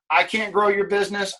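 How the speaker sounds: ordinary delivery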